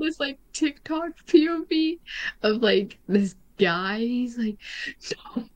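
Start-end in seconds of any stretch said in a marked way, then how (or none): 5.4-5.5 high, mock-sobbing no, no; hammed-up sobbing imitation; imitated crying. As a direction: copy a crying guy from a video. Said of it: "and, like, crying".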